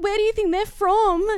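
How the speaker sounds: anxious high-pitched question. Retold or imitated.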